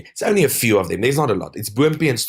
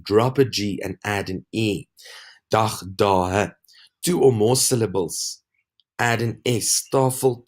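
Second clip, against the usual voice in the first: ordinary talk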